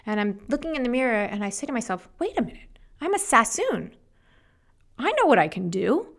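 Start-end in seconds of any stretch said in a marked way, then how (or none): 2.2-6.1 high, excited self-talk; a light bulb goes on, pitched way up; sudden realization. Retold or imitated.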